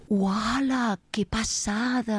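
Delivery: slow, delighted gasp of wonder; awed, stretched-out wow; amazed wow. Retold or imitated. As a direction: marvel at it, drawn out. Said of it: "i he pensat".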